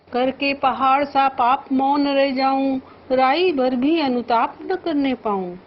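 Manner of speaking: measured, lifted verse recitation; poem recital. Shown as a demonstration. Teacher reciting verse to the class. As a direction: recite the couplet with feeling.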